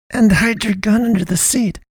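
hissed through gritted teeth; high hiss through clenched teeth. Acted. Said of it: "Naomi hissed through gritted teeth".

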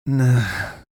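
understated sneer. A narrator's reading. Dying man dismissing the boy's question, sneering. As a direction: sneer the word lightly. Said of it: "the man sneered".

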